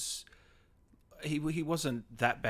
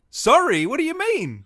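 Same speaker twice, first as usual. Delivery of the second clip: much higher and louder, playfully outraged; mock indignant; 12 semitones higher, 10 dB louder than usual. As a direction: pretend shock at the question. Mock outrage at bad memory.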